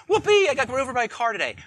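sarcastic over-the-top glee; hammed-up forced joy; fake cheer. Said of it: "I'm not saying that".

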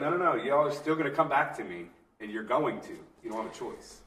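high, loud defiant Jesus voice; voicing Jesus. Jesus telling the leaders they have no choice, loud and defiant.

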